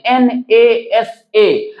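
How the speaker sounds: "एन ए एस ए" spelled slowly as separate letters, not as one word. Shown as a demonstration. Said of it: "आप नासा को ऐसे नहीं बोलते हैं". ("एन ए एस ए" अलग-अलग अक्षरों में धीरे-धीरे)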